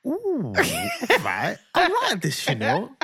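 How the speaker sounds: lightly voiced pleased kid, pitch jumping up; a hint of a delighted little boy; little boy admiring himself. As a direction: imitate a son pleased with his look. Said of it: "he was like".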